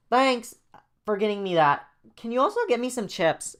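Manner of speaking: put-on very high, loud childish voice; seven-year-old kid. Jaden ordering Joey to get chips, childish voice. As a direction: demand it like a little kid. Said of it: "Jane talks like a seven-year-old".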